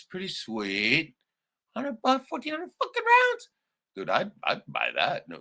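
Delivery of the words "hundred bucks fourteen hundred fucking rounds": high, thrilled voice; giddy high exclamation; excited squeal. Real, not acted. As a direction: pitch it way up in delight. Rereading the ammo deal.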